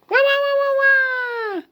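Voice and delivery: high wailing "wah wah"; wailing cry. Acted. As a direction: wail like a big baby. Elephant wailing in story.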